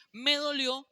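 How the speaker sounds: "Me dolió" shouted high and loud; "Me dolió" thrown out as a loud, high cry. Shown as a demonstration. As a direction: yell "Me dolió" like a hurt man losing it. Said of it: "Yo no sé decir".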